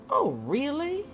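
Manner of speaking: sarcastic. Real, not acted.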